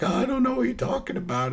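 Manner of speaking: high-pitched imitation of bawling; mock bawling. Captive answering his torturer, mock bawling. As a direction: wail it like a tortured man, hammed-up. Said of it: "this guy's just bawling".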